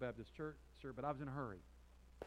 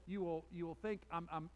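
ordinary delivery

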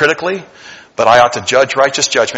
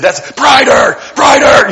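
high, loud calls for more light; insistent call; 12 semitones higher, 6 dB louder, slower than usual. Retold or imitated. Preacher retelling ordering church lights up, loud and high. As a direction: push them to crank it brighter.

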